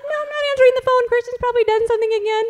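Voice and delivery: very high, fast, loud squeal of a fed-up mother; high squeaky mock panic; exasperated mom. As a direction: shriek it like a frazzled mom dodging the school's call.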